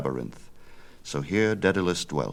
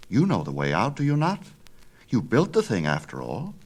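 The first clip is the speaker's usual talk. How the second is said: a lightly voiced restless boy, a bit higher; young son pleading to leave, lightly higher; eager young Icarus; 6 semitones higher than usual. Son tells his father he is weary, eager boy.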